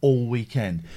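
usual speaking voice

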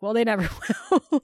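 laughing through the quote. Retold or imitated. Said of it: "And she's like".

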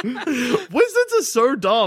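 high, slow, laughing through the words. Real, not acted. Laughing exasperation about wizards.

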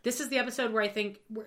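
ordinary talk

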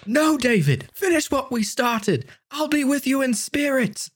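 high, slow dying words; dying hero. Acted. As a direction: noble last words, high voice. Dying brother's last words.

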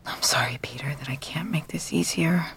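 soft unvoiced whisper to herself. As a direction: breathe the apology under your breath. Private apology under her breath.

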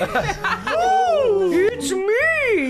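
high laughing ghost wail; ghostly wail. Pretend. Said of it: "Please don't do the ghost voice".

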